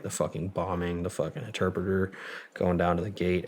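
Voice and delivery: ordinary talk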